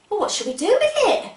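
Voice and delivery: usual speaking voice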